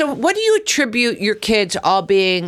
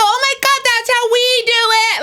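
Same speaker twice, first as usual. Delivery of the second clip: cheering yell. Retold or imitated. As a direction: scream in triumph from the sideline. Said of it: "and I go".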